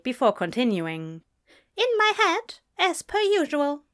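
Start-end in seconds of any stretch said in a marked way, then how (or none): 1.8-3.8 high, prim angel voice; feigned innocence. A narrator's reading. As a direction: answer primly, pitched well up.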